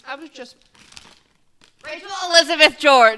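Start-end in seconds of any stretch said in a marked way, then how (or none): 1.8-3.2 loud, high full-name scold. Real, not acted. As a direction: call her out by full name. Full-name scolding over peanut allergy.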